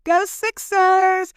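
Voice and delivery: loud, high, drawn-out cheer; stretched-out stadium yell. Retold or imitated. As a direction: shout it for the team. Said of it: "and yelled".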